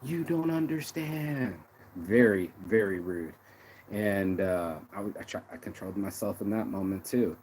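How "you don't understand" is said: high, drawn-out imitation, talking down; slow, high-pitched condescending mimicry; mock patronizing. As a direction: talk down as if patting a dog. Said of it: "tapping my head at the club going like".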